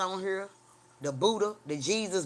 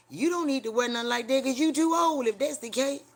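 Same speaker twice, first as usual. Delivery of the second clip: louder, higher retort; 8 semitones higher, 7 dB louder than usual. Retold or imitated. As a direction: snap back loud and high. Retold reply to the mama.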